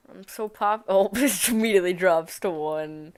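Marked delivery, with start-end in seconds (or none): none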